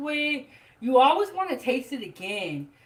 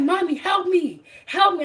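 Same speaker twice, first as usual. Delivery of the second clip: higher, louder mock plea of a scared little girl; lightly voiced frightened daughter crying for mommy; imitating panicked child; 7 semitones higher, 4 dB louder than usual. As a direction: play the kid begging for help, a bit hammed-up. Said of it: "She's like".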